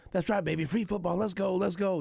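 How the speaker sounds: high, pumped-up imitation of a fired-up quarterback; quoting Mahomes all fired up on the sideline, pitched high. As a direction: hype it up, voice way up high. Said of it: "He's all fired up".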